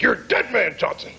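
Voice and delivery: high-pitched, lightly hammed-up threat; mock threat. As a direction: play a menacing prank caller. Acted threatening prank call to teacher.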